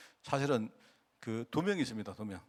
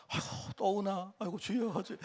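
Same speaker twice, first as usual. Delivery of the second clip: higher, softer exasperated groaning "아이고, 주여"; a weary, half-joking sigh at someone crying again; 4 semitones higher, 6 dB quieter than usual. Retold or imitated. Pastor mimicking himself sitting behind the weeping deaconess, exasperated sigh. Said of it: "나 뭐 이러고 있습니다".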